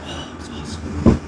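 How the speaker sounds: normal talking voice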